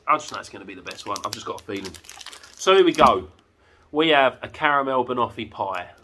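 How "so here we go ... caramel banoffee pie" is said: excited announcement. Real, not acted. Big reveal of the last fudge.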